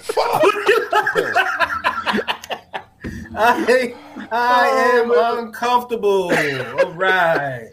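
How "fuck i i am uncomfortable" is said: loud, high flustered laugh; embarrassed laughing. Real, not acted. Reads embarrassing search result.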